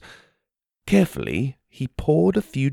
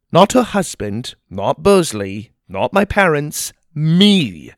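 rising, louder, breathless wonder; amazed; 6 semitones higher, 6 dB louder than usual. A narrator's reading. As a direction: build to the final me. Narrator's amazed climax.

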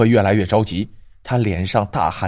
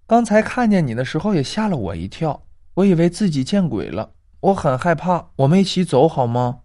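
high, timid schoolgirl voice; a scared little girl asking for company; young girl's voice; 4 semitones higher than usual. Acted. Girl begging Grandma Zhang to walk her home, high and timid.

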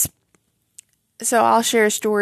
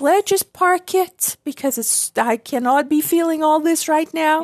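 higher-pitched voice of dismissive self-talk; inner voice; 7 semitones higher than usual. Retold or imitated. Speaking for herself about stuffing feelings, higher-pitched inner voice. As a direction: act out brushing your own feelings aside, pitched up. Acts out own suppressing thoughts.

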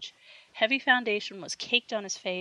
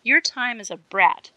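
icy; 3 semitones higher, 7 dB louder, slower than usual. A narrator's reading. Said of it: "replied Wendy icily".

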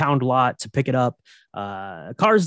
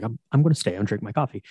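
fast, lower-pitched awkward mumbled aside; awkward Walter; 7 semitones lower, 3 dB quieter, faster than usual. Retold or imitated. Walter sits alone after the Dude leaves, mock awkwardness. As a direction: imitate sheepish stubbornness, quick and low. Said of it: "sits there very awkwardly".

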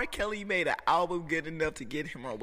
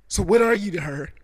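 mock challenge; 5 semitones higher, 10 dB louder than usual. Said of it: "And he was like".

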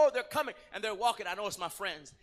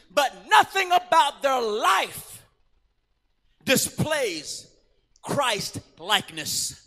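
full-force preaching; 2 semitones higher, 6 dB louder, slower than usual. Acted. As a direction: hammer the point home. Preacher stresses point.

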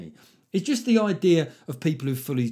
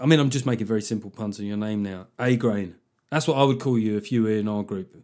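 ordinary talk

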